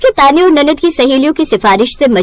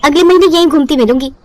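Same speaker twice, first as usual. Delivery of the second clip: put-on young bride voice. Daughter teasing her mother.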